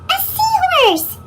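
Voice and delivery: high, slow delighted cry; squeaky cartoon voice. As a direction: stretch it out in pure delight. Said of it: "cries Pete".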